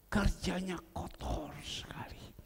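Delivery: slow breathy-free hushed whisper. Acted. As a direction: confide it in a whisper. Preacher whispers about dirty work. (berbisik pelan)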